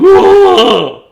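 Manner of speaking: high, loud startled whoa; loud high shocked whoa; startled yell. Acted. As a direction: yelp in fright. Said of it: "The man yelled".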